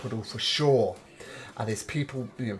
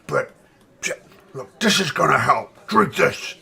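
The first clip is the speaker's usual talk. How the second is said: ogre voice; 4 dB louder than usual. Acted. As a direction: growl it out like a big ogre. [name] keeps the ogre voice.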